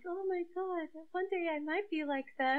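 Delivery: high, dreamy fangirl gush; starstruck fan. Retold or imitated. Young fan reading articles about idols, mock starstruck.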